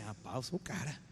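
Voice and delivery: low, soft private boast; hushed, low self-congratulation; smug self-talk. Acted. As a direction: think aloud, quietly impressed with yourself. Imagined listener privately boasts after calling.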